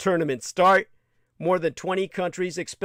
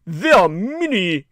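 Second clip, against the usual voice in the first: put-on a rough, louder stab at an Italian accent; mock Italian accent for Infantino, half-hearted; Italian accent attempt; 3 semitones higher, 6 dB louder than usual. Reading Infantino's words as a narrator, mock Italian accent. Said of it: "Or should I give it his accent?".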